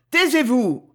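loud, high outburst; yelled command. A narrator's reading. Sorcerer cutting off the servant, angry shout. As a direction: shout him down sharply. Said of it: "hurla Lorenzo".

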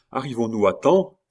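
loud, lifted question; called out. A narrator's reading. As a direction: call it out as you arrive. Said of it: "cria une voix bien connue".

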